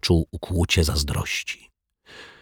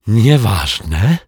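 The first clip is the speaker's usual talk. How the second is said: raised, louder burst of relief; relieved exhale; 4 semitones higher, 5 dB louder than usual. A narrator's reading. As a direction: let it out as the breath returns. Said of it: "odetchnęła pani Ryczaj". (z ulgą, podniesionym głosem)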